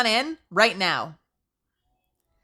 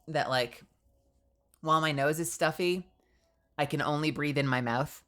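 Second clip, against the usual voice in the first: ordinary talk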